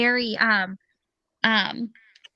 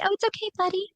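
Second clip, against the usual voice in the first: put-on higher, softer baby-talk reassurance; soothing the cat; 8 semitones higher, 4 dB quieter, much faster than usual. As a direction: coo it to a startled cat. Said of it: "I'm like".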